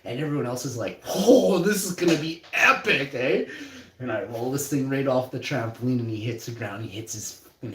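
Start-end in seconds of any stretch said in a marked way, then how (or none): 1.1-3.4 hyped onlookers. Retold or imitated. Crowd hyping the fight.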